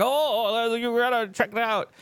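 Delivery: high, eager imitation of an excited friend; mock excited friend. Friend showing off new tech, high mock-excited voice.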